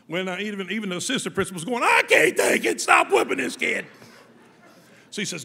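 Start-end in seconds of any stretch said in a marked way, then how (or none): 1.8-3.8 high, loud begging cry; pleading shout. Retold or imitated. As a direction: yell at him to stop. Assistant principal begs him stop.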